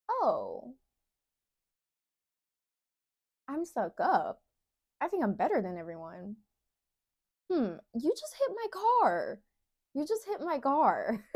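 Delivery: sarcastic mockery. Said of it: "that's how you know, like".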